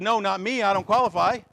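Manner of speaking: high, loud protest; loud protesting refusal. Acted. Preacher as Moses turning down God's call, loud protest. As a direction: plead unfitness at full voice.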